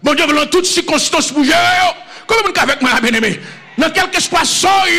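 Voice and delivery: loud, high-pitched preacher's call; full-force sermon shouting; fiery preaching. Acted. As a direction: preach it out to the whole congregation.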